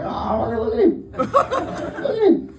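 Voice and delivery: high, drawn-out mock parental cooing; amused parent impression. Comedian mimics parents watching their rude toddler, amused and high.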